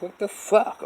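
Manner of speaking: high, startled what the fuck; shocked outburst. Retold or imitated. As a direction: yelp it out in disbelief. Retelling his shock at the voice.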